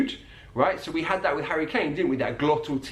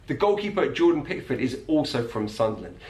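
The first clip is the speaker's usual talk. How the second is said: usual speaking voice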